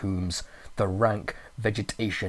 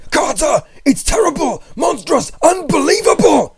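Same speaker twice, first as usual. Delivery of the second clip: high, loud, frantic terror; frenzied; 13 dB louder than usual. A narrator's reading. Friend crying up the phone in frenzied terror. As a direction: shriek it out in panic. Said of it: "Then came the frenzied tones again".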